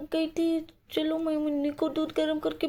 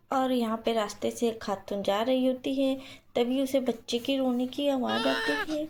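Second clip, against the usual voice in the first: story narration; 4 semitones lower than usual. A narrator's reading. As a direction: narrate the scene calmly and low.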